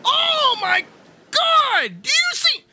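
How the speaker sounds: amazed shouting. Real, not acted. Artist stunned by his redrawn eyes, shouting. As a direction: shriek with delight at the result.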